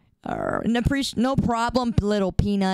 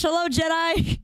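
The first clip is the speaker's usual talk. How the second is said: shouted hello; 7 semitones higher than usual. Acted. Shouted greeting.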